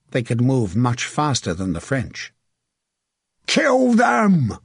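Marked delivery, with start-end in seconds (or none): 3.5-4.6 high, drawn-out battle bellow. A narrator's reading. Archer taking up the battle cry, bellowing. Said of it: "Evel Gold bellowed".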